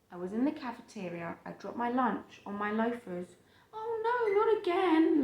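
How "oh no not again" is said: high, pained oh no from the book character; dismayed cry. Acted. Bean spilling lunch on his shoes, high dismayed cry. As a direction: squeal it like a kid who spilled lunch again. Boy drops lunch on loafers.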